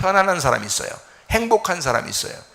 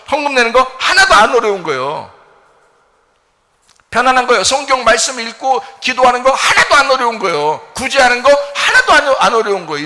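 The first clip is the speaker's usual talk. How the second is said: loud, high insistent preaching; fervent preaching; 9 semitones higher, 9 dB louder than usual. Acted. Pastor insisting to the congregation, loud fervent preaching. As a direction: hammer the point home to the pews.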